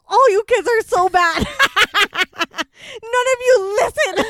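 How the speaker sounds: high, loud, slow scolding broken by laughter; cracking up mimicking an angry dad; dad voice through laughter. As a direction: laugh while imitating dad. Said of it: "My dad's just like".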